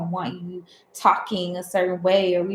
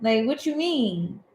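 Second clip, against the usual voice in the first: innocent, puzzled reply, much higher; mock-innocent confusion; 7 semitones higher than usual. Friends answering the confused guy, mock-innocent and puzzled. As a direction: play dumb, pitch jumping up on normal. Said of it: "And we like".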